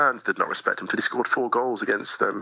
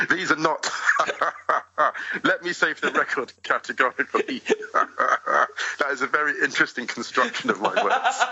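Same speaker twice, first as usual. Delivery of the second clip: talking through real laughter, pitched up; laughing; 7 semitones higher than usual. Real, not acted. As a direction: chuckle through the denial. Host teases him, he laughs.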